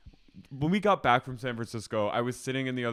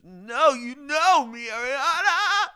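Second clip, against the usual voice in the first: hammed-up plea, loud, high and stretched out; mock overwrought wail; 9 dB louder, much slower than usual. Retelling him begging Ariana, mock wail.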